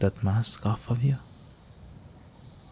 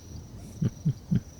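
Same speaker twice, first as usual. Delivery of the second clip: soft laugh; 6 semitones higher, 4 dB quieter than usual. Acted. Vampire amused by prey.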